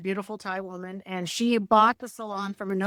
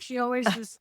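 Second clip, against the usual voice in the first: normal talking voice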